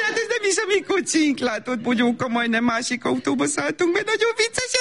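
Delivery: very high, silly sing-song; embarrassed forced cheer. Acted. Mother at the wrong car, embarrassed and over-cheery. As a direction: laugh off a blunder in a squeaky-high cheery voice.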